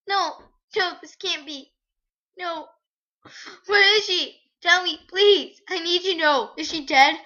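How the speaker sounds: frantic, loud, high pleas; begging to know if her friend lives; desperate pleading. Acted. Girl begs the woman for news, frantic and loud.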